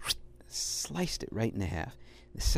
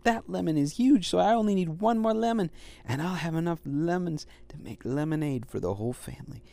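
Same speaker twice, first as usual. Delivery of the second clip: put-on higher, eager young puppy voice; Little Dog voice; 6 semitones higher, 3 dB louder than usual. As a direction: play the hopeful little dog. Little Dog's voice in story.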